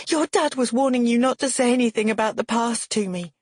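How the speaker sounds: high, insistent accusation; exclaiming. A narrator's reading. Woman reveals what the text warned, exclaiming. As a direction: exclaim the evidence heatedly. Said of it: "I exclaim".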